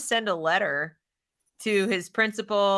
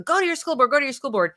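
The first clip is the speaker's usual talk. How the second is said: urging; 6 semitones higher, faster than usual. Retold or imitated. Quoting people urging action.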